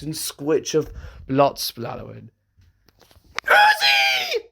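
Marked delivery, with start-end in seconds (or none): 3.4-4.5 high, loud scream of a name; anguished scream. Acted. N screaming as Uzi is killed. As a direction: cry out in horror. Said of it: "screamed N, crying in fear".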